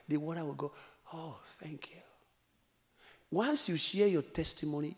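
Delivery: ordinary talk, nothing marked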